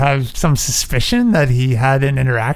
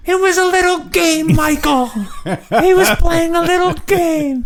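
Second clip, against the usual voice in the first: much higher put-on voice, slowing to the end; high mock voice; 14 semitones higher than usual. Teasing film lines in a high mock voice. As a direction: ham it up in a high voice.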